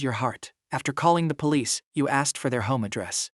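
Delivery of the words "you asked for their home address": plain reading voice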